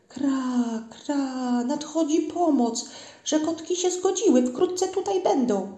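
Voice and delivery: high, rising bird announcement; cawing rook's call. A narrator's reading. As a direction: call out the news high, stretching the endings. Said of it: "wołał gawron w locie". (wysokie wołanie gawrona w locie)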